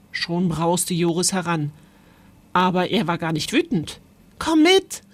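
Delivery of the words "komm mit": höher und lauter, aufgeregt lockend; eifriger Ruf. Acted. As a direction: zieh ihn eifrig mit. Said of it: "rief er". (aufgeregt rufend, höher)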